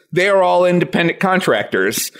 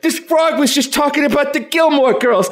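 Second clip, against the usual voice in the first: high-pitched, desperate insistence; frantic insisting witness; 8 semitones higher than usual. Acted. Witness telling people the frog talked, acted frantic insistence. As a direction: plead that you really saw it. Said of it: "And you'd be like".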